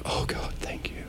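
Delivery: breathless, whispered thanks; grateful whisper. Retold or imitated. Telling a friend how a compliment on his writing makes him whisper gratefully. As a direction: whisper it like a prayer answered. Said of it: "I immediately am like".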